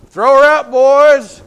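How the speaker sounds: loud, high shouted command; a king roaring his order up at the window. Retold or imitated. Jehu ordering Jezebel thrown down.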